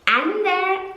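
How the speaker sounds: Ander lifted high and savoured; Ander said lovingly. Shown as a demonstration. Vlogger savouring her favourite name, lifted high. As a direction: say Ander sweetly, rising in delight. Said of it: "it is Ander".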